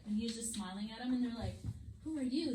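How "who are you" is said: ordinary talk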